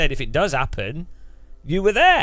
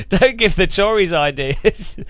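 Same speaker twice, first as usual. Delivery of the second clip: amused protest, raised and louder, through laughter; laughing; 5 semitones higher, 4 dB louder than usual. Real, not acted. Streamer joking with Daniel about giving politicians ideas, laughing. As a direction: say it while cracking up.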